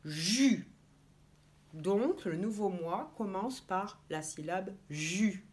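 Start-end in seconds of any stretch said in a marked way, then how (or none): 0.0-0.7 "jus" said as one joined syllable, J sliding into U without a stop; blended syllable. Shown as a demonstration. Teacher blends J and U into one syllable. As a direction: glide straight from zh into u in "jus". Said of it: "mais qu'on ne s'arrête pas".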